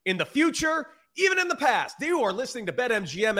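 booming circus ringmaster intro. Acted. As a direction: announce it like a big-top showman. Podcast opener, showman announcement.

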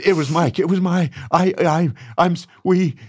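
high-pitched, stammering imitation of panic; retold flustered confession. David confesses to the brawlers, flustered stammer. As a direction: stumble over the words, mock-frantic.

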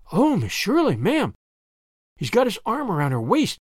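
understated excitement, not truly whispered; delighted. A narrator's reading. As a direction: share juicy news with delight. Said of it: "whispered Charlotta the fourth, delightedly".

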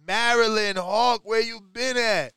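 loud, slow call to a caller. Real, not acted. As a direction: call him out loud across the room. Host calling out to caller.

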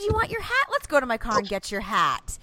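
high-pitched mock-cheery coaxing; hammed-up sugary mother voice; bipolar sweet mom. Mom soothing crying son about his hat, mock-sweet cheer. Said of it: "Pulled it so bipolar".

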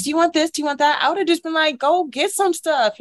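mock flurry of helpful questions; retold fussing. Flight attendant offering things to a sick passenger, retold fussing.

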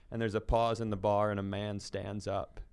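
ordinary talk

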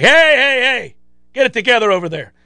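playful mock reprimand; mock scolding crowd. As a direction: call out a sneezer in the store. Whole store scolding a sneezer.

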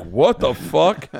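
baffled disbelief. Retold or imitated. Audience reacts to bad joke.

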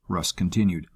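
plain reading voice

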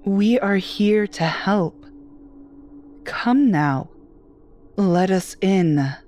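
slow, pressing and eerie; a hint of a sinister urge; insistent. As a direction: lean in and push her to give in. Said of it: "My voice becomes insistent".

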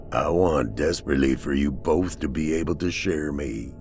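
low, eerie monotone; possessed man. A narrator's reading. Possessed Trey addressing Pat and Celeste, low and eerie. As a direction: intone it low and ominous.

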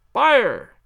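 loud shout of fire; shouting fire again on request; yell. Acted. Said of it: "The shout must have rung over Norwood".

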